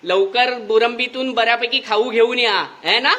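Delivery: ordinary delivery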